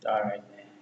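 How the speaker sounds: soft, low-key acted reply; calm acceptance. Retelling Donkey accepting Shrek's apology, calm. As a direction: accept it quietly, no fuss.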